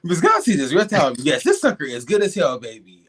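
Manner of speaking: loud tipsy rambling. Real, not acted. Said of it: "I'm a little tipsy".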